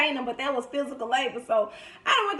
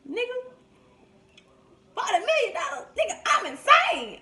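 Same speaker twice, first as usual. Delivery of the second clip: laughing high Mike Epps impression; hammed-up disbelief, laughing; Mike Epps quote; 9 semitones higher than usual. Retelling a movie line about money, laughing disbelief. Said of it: "Mike Epps on the movie".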